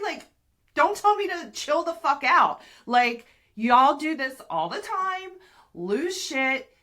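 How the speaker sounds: high and loud, ready to fight; biting back hard at being told to chill; furious telling-off. Retold or imitated. Wife snapping back at husband who says chill, furious.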